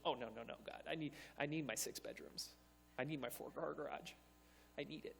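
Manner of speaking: low, hushed mock protest; small, soft, needy refusal; reluctant pleading. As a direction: beg quietly to hang on to comforts. Acted refusal to give up house.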